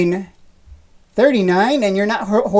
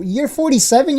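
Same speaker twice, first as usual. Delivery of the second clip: rising disbelief, pitched up; incredulous; 6 semitones higher than usual. Real, not acted. Streamer doubting a viewer's claimed age, incredulous. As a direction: sound floored by the number.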